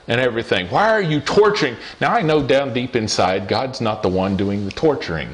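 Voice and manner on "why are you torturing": pitched-up retold cry; a hint of the fist-shaking yell; angry cry to God. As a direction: demand an answer from the sky, mildly. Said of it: "yelling at the top of my lungs".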